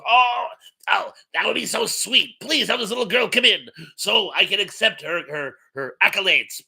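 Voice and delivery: mock gushing delight at meeting a fan; a hint of a fawning celebrity; gushing Gilbert Gottfried. Comedian retold, gushing at tour guide.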